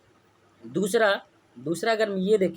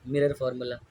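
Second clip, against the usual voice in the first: Mirror said lower and firm, echoing the question; answer low; 6 semitones lower than usual. Shown as a demonstration. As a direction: drop into a settled answer on mirror. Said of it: "क्या पढ़ना है?". (सवाल के बाद धीमा, ठहरा जवाब)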